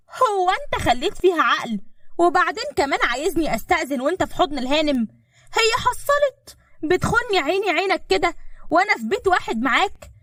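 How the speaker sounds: high-pitched indignant accusation; outraged. A narrator's reading. Fiancée accusing him of cheating in front of her, outraged. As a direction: accuse him, pitch soaring without shouting.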